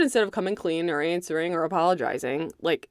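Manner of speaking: ordinary talk, nothing marked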